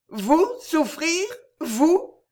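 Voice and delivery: high and slow, in disbelief; utter astonishment. A narrator's reading. Camilla answers Edgar's complaint in high, astonished disbelief. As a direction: gasp it out, unable to believe him. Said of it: "avec l'expression du plus grand étonnement".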